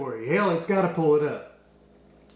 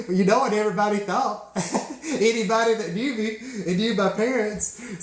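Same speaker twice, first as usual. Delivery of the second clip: words broken up by real laughter; higher, giggly delivery; laughing while talking; 5 semitones higher than usual. Real, not acted. Storyteller recalls parents' phone blowing up, laughing.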